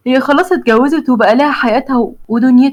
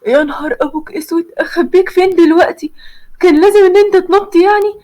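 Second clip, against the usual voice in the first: climbing higher in alarm; frantic, where do I hide you; panic; 6 semitones higher than usual. Acted. The girl panics as someone knocks at her door, rising higher.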